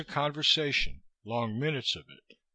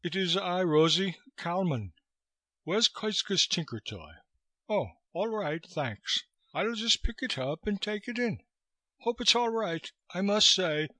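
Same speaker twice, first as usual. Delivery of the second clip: put-on higher-pitched, lightly Oxford-accented English; faint Oxford accent; 7 semitones higher than usual. A narrator's reading. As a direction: speak as a weary Oxford man. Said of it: "with a faint Oxford accent".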